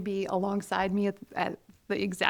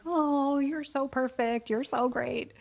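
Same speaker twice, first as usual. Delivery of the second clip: higher-pitched mock gushing praise; gushing teacher; 5 semitones higher, slower than usual. Other teachers praising their star pupil, mock gushing. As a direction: lightly imitate a doting teacher cooing. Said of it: "every other teacher had been like".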